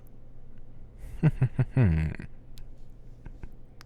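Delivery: drawn-out evil laugh; villain laugh. Shown as a demonstration. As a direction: cackle like a scheming villain. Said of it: "My evil laugh".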